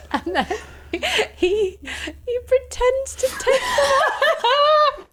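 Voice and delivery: laughing so hard the voice cracks high; words squeezed out through laughter. Real, not acted.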